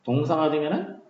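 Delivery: usual speaking voice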